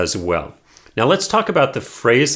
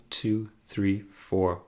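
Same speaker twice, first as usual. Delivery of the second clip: "two, three, four" dropped very soft after beat one; 3 semitones lower, 10 dB quieter, much slower than usual. Shown as a demonstration. Teacher counts the bar, stressing beat one and hushing the rest. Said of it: "emphasis each time on beat one".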